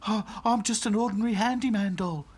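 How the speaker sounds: high, agitated handyman doll; flustered Back to Front. A narrator's reading. Handyman doll explaining himself to princess dolls, flustered.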